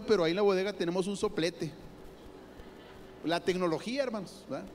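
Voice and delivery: usual speaking voice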